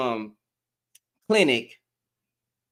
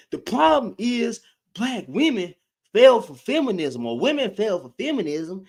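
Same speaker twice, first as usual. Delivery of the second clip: higher, mocking voice of sneering men; mocking those dudes; 5 semitones higher than usual. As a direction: parody how these guys talk about women. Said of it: "That's how they talk".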